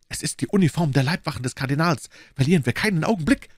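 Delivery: high, urgent outcry, speeding up; alarmed cry. A narrator's reading. Milady spots the Cardinal's guards, alarmed cry. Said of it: "schrie My Lady".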